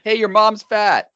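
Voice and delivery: high, slow, loud taunt; dumb heckler. Retold or imitated. Mocking the Young Bucks' taunt, high slow and loud. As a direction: drag out the childish insult. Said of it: "They're just like".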